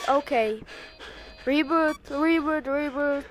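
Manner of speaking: ordinary delivery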